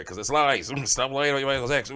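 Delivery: loud mocking laugh on the repeat. Real, not acted. Same retort repeated louder.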